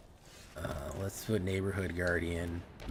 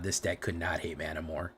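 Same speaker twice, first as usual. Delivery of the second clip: ordinary delivery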